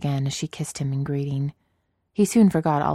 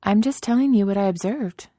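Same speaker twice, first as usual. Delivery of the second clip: shrugging Padme; 6 semitones higher, 3 dB louder than usual. Acted. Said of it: "with a shrug".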